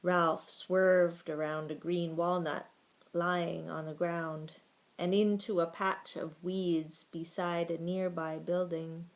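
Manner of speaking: usual speaking voice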